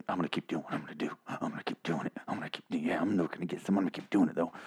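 low, soft gravel of a threat; low growl. Retold or imitated. Dwight pinning Eugene against the wall, low menacing growl. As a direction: growl it low under your breath. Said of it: "And he's like".

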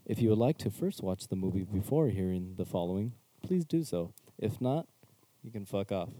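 slow, hushed announcer-style warning; mock formal disclaimer. Podcast host reading a spoiler warning, mock formal. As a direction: read it like an official notice, deadpan.

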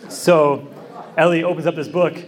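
usual speaking voice

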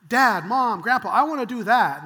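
put-on loud, excited kid pleading; eager child. Eager child begging at fair.

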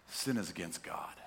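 usual speaking voice

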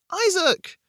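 high, loud scream of a name; desperate, high-pitched yell. A narrator's reading. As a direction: scream out to a friend under attack. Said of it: "I screamed".

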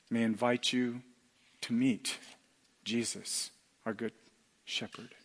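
a hushed, tender invitation to the listener; soft invitation. Real, not acted. Gentle invitation to meet Jesus.

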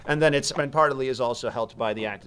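normal talking voice

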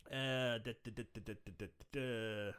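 very soft da da da fading out; trailing off quietly. Real, not acted. Pausing to think, softly dada-ing. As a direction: mumble the filler, fading away. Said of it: "humming filler while thinking".

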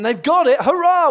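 loud, high hurrah; rising to a loud, celebratory cry; mock cheer. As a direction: cheer it out for the crowd. Preacher cheers joyfully in retelling.

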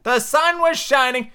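high, slow poem opening; high storybook-twin recitation; Tweedledee reciting. Acted. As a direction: recite grandly, high and drawn out.

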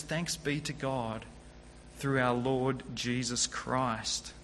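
a grateful prayer spoken low and slow, like relief after anguish; hushed thanks. Retold or imitated. The preacher voices Paul thanking God for rescue, hushed and slow.

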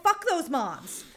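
high, loud, drawn-out outburst; emphatic comic rant; loud proclamation. Acted. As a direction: declare it loudly, stretching each word. Proclaims what they angrily wrote.

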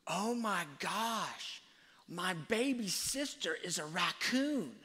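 high, drawn-out mock horror; aghast exclamation. Other son announcing his baby sister is a raccoon, mock-horrified exclamation. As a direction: imitate an appalled kid exclaiming loudly.

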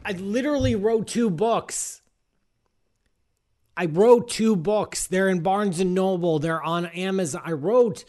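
loud exasperated protest; indignant shouting, half joking; mock outrage. Mock outrage at astrology question.

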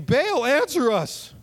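high, slow, drawn-out cry to Baal; high slow mock plea; mock pagan plea. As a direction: call out to Baal like a desperate worshipper. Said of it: "Probably sounded something like that".

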